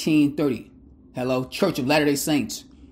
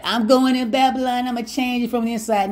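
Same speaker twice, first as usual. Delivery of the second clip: higher, mocking voice of a naive believer; mock churchgoer; 8 semitones higher than usual. Preacher mocking a churchgoer who stays in Babylon, higher voice. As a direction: lampoon the fool who thinks he'll reform Babylon from inside.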